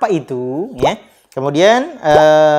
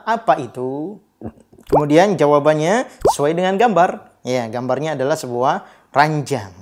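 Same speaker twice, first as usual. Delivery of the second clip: normal talking voice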